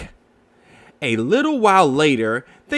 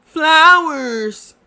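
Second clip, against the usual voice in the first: put-on high, louder child's shout; eager little Roo cry; 12 semitones higher, 7 dB louder than usual. Roo answers Eeyore, high and loud. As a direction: blurt it out eagerly. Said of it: "cried Roo".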